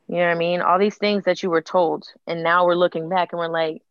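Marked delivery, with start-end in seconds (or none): none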